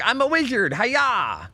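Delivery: hammed-up nerd wizard, slow on "I cast spells"; mock nerd wizard. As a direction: lampoon a dorky spellcaster. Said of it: "He's like".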